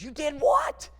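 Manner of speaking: high, startled squeak of disbelief; shocked outburst. Retold or imitated. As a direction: shriek it in shock like the girlfriend. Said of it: "She goes".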